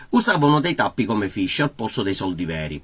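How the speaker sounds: usual speaking voice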